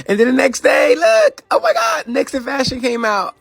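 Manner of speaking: high, loud squeal of excitement; excited shriek. Real, not acted. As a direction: burst out thrilled about the show. Excitedly announcing her own TV show.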